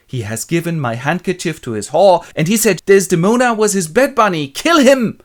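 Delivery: loud rage rising to a shout; enraged. Acted. Husband jumps from hiding to order a killing, mock fury.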